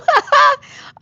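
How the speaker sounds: loud, high burst of laughter; delighted laugh. Real, not acted. Reacts to viewer's compliment.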